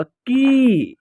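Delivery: की with a long, stretched, steady ee; drawn-out vowel. Shown as a demonstration. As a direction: hold the vowel of की for a while. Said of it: "खींच करके होगा".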